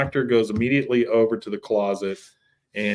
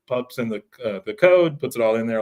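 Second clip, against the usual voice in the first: ordinary talk, nothing marked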